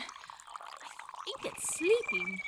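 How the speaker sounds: a slow whisper so as not to wake it; hushed whisper. Acted. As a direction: whisper slowly beside a sleeper. Whispering near sleeping dormouse.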